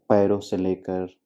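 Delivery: ordinary delivery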